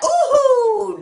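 a high, loud, pleased ooh. Real, not acted. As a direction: let out a delighted ooh. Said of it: "didn't that just feel good".

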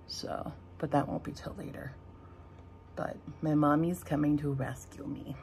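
hushed whisper. Real, not acted. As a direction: drop to a whisper. Unvoiced whisper.

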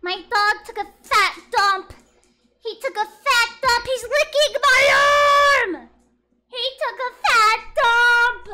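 loud, slow, drawn-out announcement; excited shout. Real, not acted. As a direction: yell it to the whole chat. Shouting to chat about dog.

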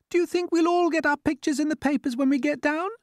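very high-pitched little insect voice; ladybird asking. A narrator's reading. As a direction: ask eagerly in a tiny high voice. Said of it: "the ladybird asked".

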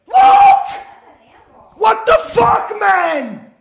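high-pitched, drawn-out yells of protest; exasperated shouting. Real, not acted. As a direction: howl in disbelief at the match. Protesting calls during live match.

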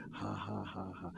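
soft, low faith laugh; quiet mock laughter at the enemy; a demonstration laugh, held back; demonstrated laugh. Shown as a demonstration. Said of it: "Just try to laugh".

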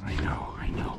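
soft whispered soothing; whispering. Real, not acted. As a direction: hush the dog gently. Soothing the excited dog.

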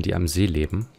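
usual speaking voice

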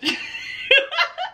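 high giggling burst; high laugh. Real, not acted. Laughing as she asks viewers if she'll pass. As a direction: squeal a laugh.

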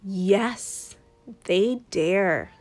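usual speaking voice

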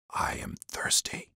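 a faint, slow whisper. A narrator's reading. Jesus on the cross, a slow faint whisper. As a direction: breathe it out weakly, dying.

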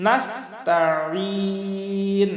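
slow "নাস্তাইন", long IN from mid-throat; throaty ayn. Shown as a demonstration. Teacher modelling the ayn, slow throaty recitation. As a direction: press the ayn in "নাস্তাইন" and hold it. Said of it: "হলকের মধ্য থেকে আমরা উচ্চারণ".

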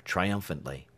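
ordinary delivery

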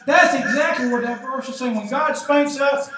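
usual speaking voice

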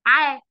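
عا said as a clear open ain-a; a teacher modeling عا with a full open a; ain with fatha. Shown as a demonstration. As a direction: sound out عا from the throat, vowel open. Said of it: "يعني صوتها".

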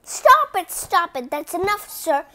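high, loud cry of stop; loud pleading stop; shouted protest. Acted. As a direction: yell at him to stop. Character protests loudly.

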